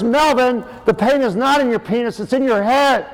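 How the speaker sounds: high-pitched scolding wife, imitated; exasperated mother. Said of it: "My mother goes".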